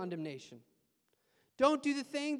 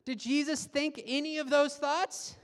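normal talking voice

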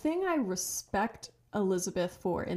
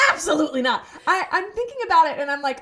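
loud and laughing, higher; laughing refusal, raised and loud; 7 semitones higher, 8 dB louder, faster than usual. Real, not acted. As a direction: laugh out a firm no. Laughing emphatic denial.

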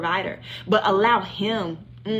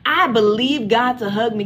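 ordinary talk

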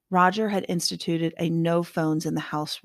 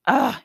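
frustrated groan; 8 semitones higher, 7 dB louder than usual. Acted. Frustrated boy sent to search.

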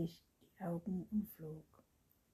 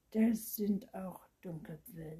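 louder, slow, mild contempt; understated disdain, firmly voiced; contemptuous; 7 dB louder, slower than usual. A narrator's reading. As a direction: pronounce it slowly with some scorn. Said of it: "der Vampir verächtlich".

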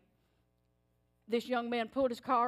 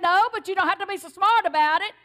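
loud, higher, sassy comeback; sassy retort; 7 semitones higher, 10 dB louder than usual. Retold or imitated. Wife answering her husband's challenge, loud and sassy. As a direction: snap back with spirit.

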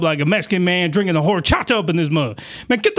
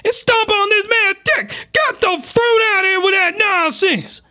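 outraged; 10 semitones higher than usual. Real, not acted. Outraged reaction to video scene.

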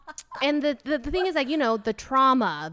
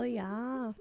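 put-on Midwest accent; 9 dB quieter than usual. Co-host trying the accent once more, quiet Midwest accent. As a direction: toss off a soft Midwest accent. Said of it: "that was a really good accent".

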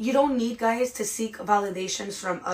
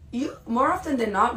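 usual speaking voice

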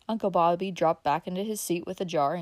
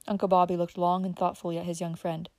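ordinary delivery

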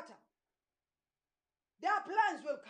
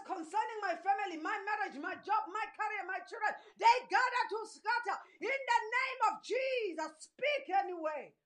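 loud, higher and fast declaring; preaching at full force; 6 semitones higher, 7 dB louder than usual. Acted. Preacher voicing a sister's prayer loudly. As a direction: pour out the declaration with fire.

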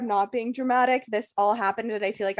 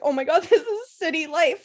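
high wail rising into a laugh; mock crying; 6 semitones higher than usual. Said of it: "crying in my head".

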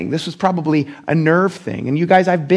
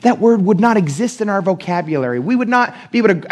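usual speaking voice

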